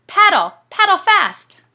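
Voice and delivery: high, loud urgent call; urgent shout. Acted. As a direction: yell at Dad to paddle. Urging Dad to paddle.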